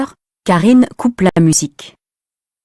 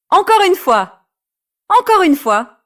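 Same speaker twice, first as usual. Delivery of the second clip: high eager plea; 11 semitones higher than usual. Acted. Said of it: "dit Rémi".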